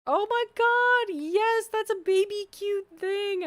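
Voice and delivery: high, loud squeal of delight; squealing excited. Real, not acted. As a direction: gush over something cute. Gushing over cute item.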